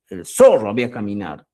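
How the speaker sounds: "zorro" hit hard, the rest quick and falling; emphatic. Shown as a demonstration. Teacher confirming who is being emphasised, stress on zorro. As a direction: punch "zorro", then hurry through "había caminado". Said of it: "Solo estoy dando énfasis".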